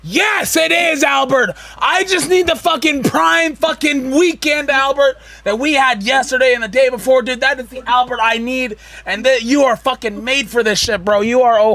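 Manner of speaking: loud, high pep-talk yelling; hype shout. Real, not acted. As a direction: pump him up, shouting.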